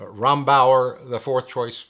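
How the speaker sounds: ordinary delivery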